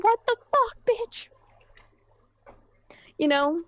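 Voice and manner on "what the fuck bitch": outraged disbelief. Retold or imitated. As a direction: squeal it like you can't believe what you're seeing. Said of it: "me and Odie were just like".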